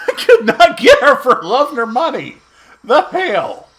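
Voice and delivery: high, loud angry outburst at the game; frustrated shout. Real, not acted. Gamer yelling at his screen in frustration, loud and high. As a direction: yell it out, fed up.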